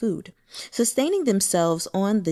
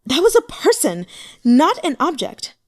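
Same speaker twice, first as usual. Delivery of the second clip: loud, high, barely held anger; restrained anger; 7 semitones higher, 7 dB louder than usual. A narrator's reading. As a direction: hold back your fury, then let it out. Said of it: "Holding herself back with fists clenched".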